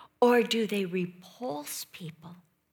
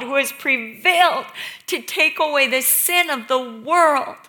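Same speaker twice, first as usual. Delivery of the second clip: loud, high, full-force preaching; raised pulpit voice, punching each phrase; impassioned preaching; 6 semitones higher, 9 dB louder than usual. Real, not acted. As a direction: cry it out to the congregation. Preacher builds to impassioned outcry.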